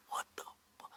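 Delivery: whispered shocked curse, cut off. Retold or imitated. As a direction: breathe the curse out in disbelief. Shocked, quoting himself under breath.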